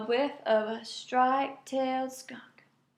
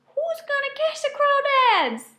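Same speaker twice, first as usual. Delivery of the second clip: call; 5 dB louder than usual. A narrator's reading. Gator calls out to critters.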